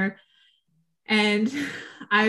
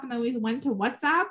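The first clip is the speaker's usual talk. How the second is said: usual speaking voice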